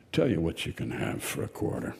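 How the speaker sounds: hushed fish. A narrator's reading. Fish confiding to girl.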